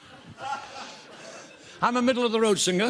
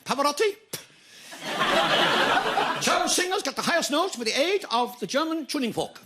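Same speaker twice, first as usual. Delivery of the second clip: put-on German accent; 5 semitones higher, faster than usual. Boastful German character speech.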